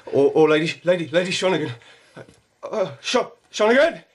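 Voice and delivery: very high, loud desperate shouts; frantic yell for Shonigan; panicked calling. Acted. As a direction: scream her name for help.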